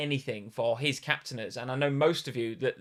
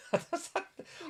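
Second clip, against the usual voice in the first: high giggle; 8 dB quieter than usual. Real, not acted. Laughs at a viewer's comment.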